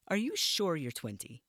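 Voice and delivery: pressing him on his age; suspicious matron. Acted.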